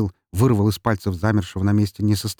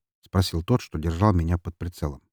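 ordinary delivery, nothing marked

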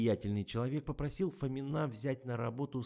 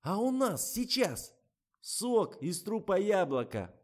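high, loud, slow mock announcement; gleefully overdone high pitch; carried away; 10 semitones higher, 6 dB louder, slower than usual. A narrator's reading. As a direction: announce it with relish, stretching the key words. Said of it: "но Лизу понесло дальше".

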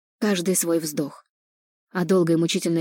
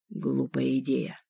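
low, soft, slow inner voice; voice in her head; 4 semitones lower, 7 dB quieter, slower than usual. A narrator's reading. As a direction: speak quietly inside her mind. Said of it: "раздался в голове голос".

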